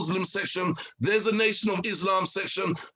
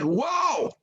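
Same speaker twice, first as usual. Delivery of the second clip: amazed wow; 10 semitones higher than usual. Retold or imitated. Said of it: "he's like".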